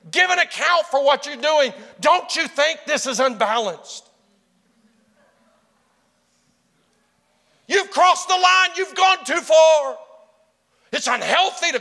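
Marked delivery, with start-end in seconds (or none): 0.1-10.0 loud, high accusing challenge; pastors' accusation. Retold or imitated. Pastors confronting the preacher, mock shouted rebuke. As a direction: throw the charge at him like a rebuke. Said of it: "they would ask me".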